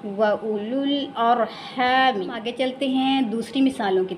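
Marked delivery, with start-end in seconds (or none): 0.1-2.3 व उलुल अरहामी slowly with short u, long haa; correct short vowel. Shown as a demonstration. Teacher gives the right reading, slow recitation. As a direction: keep u brief in व उलुल अरहामी, hold haa. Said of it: "क्या पढ़ेंगे".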